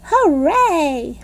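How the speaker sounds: high cheering hooray; shouted hooray. A narrator's reading. Two girls cheering after the rescue, high. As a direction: cheer the victory. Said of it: "we shouted".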